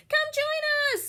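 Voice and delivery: very high, bright call; cheerful invitation. A narrator's reading. Millie calling Beatrice onto the ice, excited. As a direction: sing it out to a friend. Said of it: "said Millie".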